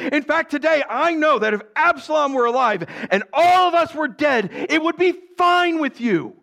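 high, insistent acted scolding; indignant rebuke. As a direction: read Joab's reproach with mounting force. Joab rebukes the king.

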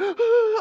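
high, sharp re-enacted gasp; panicked gasp. Retelling his fright watching baby in traffic, panicked gasp. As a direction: gasp as if watching a near miss. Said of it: "I'm, like, freaking out".

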